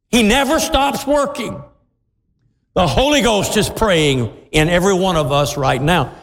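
rising, fervent preacher's cry; preaching at full force. Acted.

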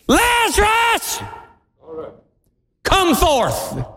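yelled command. Acted. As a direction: call the dead man out of the tomb. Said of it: "so pardon me, I'm gonna yell".

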